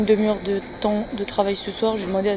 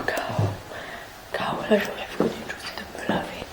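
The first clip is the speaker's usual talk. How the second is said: chuchoté; 7 dB quieter than usual. Real, not acted. Chuchote dans l'immeuble le soir.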